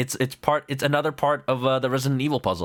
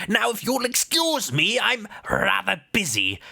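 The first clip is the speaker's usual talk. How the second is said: higher, a hint of a posh detective; breezy dismissal, voiced a bit higher; lightly voiced Sholmes; 7 semitones higher than usual. Detective excusing himself to busy himself with tea. Voicing Sholmes in game dialogue.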